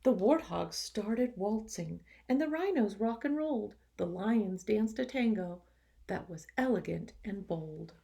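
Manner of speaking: lively, expressive storybook reading; reading to children. A narrator's reading. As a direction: read it to the class with energy. Teacher reads picture book aloud.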